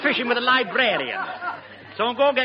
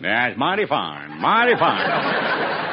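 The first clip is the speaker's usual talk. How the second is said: very slow, drawn-out mock-American drawl; low, stretched American accent; Bob Hope; 10 semitones lower, much slower than usual. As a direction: drawl it out like a comic American westerner. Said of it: "my impersonation of Bob Hope talking English".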